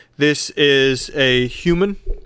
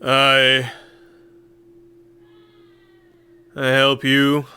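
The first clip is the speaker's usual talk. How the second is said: gruff caravan leader voice; slower than usual. Acted.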